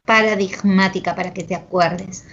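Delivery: ordinary talk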